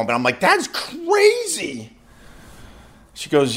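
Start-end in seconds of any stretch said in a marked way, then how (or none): 0.4-1.9 very high, stretched-out disbelief; retold reaction of sheer disbelief; incredulous. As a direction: draw it out high in amazement. Retold disbelief at vet's decision.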